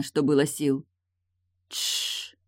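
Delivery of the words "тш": soft, voiceless shushing; gentle "shh"; soothing hush. A narrator's reading. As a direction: hush someone to calm them. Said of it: "начала успокаивать её Эйлин".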